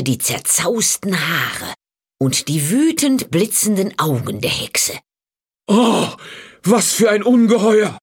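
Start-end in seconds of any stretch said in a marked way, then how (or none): 5.7-8.0 higher, louder startled cry; frightened cry. A narrator's reading. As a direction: recoil in shock from the monster. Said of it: "schrie der Bauer".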